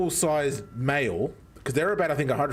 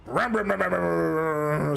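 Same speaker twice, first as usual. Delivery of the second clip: engine noise; 3 semitones higher than usual. Retold or imitated. Engine starting.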